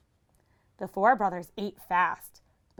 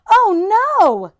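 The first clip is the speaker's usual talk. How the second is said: much higher, much louder; alarmed cry; 12 semitones higher, 12 dB louder than usual. Acted. Sadie realizing the disaster, alarmed cry. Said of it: "she cried".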